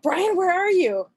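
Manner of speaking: burned and mad, yelling for her husband; angry yell for husband. Retold or imitated. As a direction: call out for Brian, fed up and hurting. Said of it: "I was getting kinda mad".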